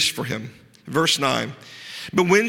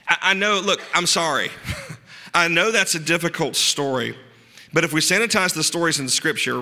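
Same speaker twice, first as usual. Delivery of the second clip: usual speaking voice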